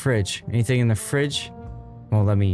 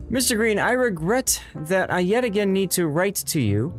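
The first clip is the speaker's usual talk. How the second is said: higher, measured formal letter-writer voice; formal letter read in a lifted voice; prim landlord's letter; 6 semitones higher than usual. Acted. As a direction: read it like a stern, proper letter.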